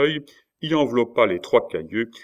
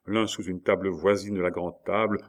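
ordinary delivery

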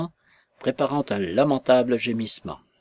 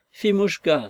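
near sobbing cry; 6 semitones higher than usual. Acted. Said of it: "s'écria-t-il presque avec des sanglots".

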